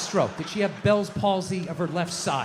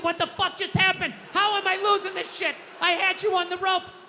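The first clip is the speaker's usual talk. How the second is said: exasperated bewilderment; 9 semitones higher than usual. Acted. Acts bewildered loser of an argument.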